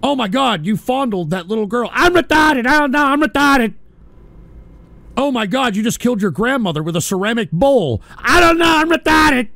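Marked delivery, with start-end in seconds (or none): 1.9-3.7 mocking dumb voice. 8.2-9.5 mocking dumb voice.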